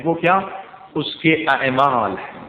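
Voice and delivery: ordinary talk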